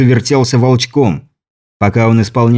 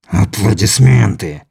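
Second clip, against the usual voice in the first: menacing alien; 2 semitones lower, slower than usual. A narrator's reading. Alien voiced by narrator. (низкий зловещий голос чужака)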